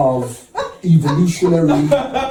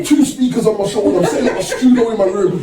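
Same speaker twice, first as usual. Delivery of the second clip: laughing while telling; 9 semitones higher, 3 dB louder than usual. Real, not acted. Laughing while telling dorm story.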